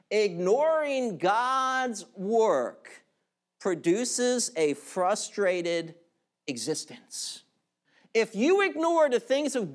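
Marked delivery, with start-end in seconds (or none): 0.1-7.4 slower repeat. Shown as a demonstration.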